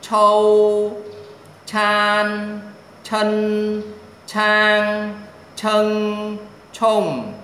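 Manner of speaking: high, loud, level syllable chanting; sustained high-level tone on every syllable; syllable drill. Shown as a demonstration. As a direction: hold each syllable high and even, like a pronunciation drill.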